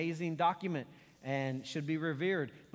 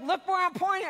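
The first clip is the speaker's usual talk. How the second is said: much higher, louder exasperated yell; a retold shout of pure frustration; mad at the kids; 14 semitones higher, 8 dB louder than usual. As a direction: snap it out at kids who won't look. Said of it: "And I get mad".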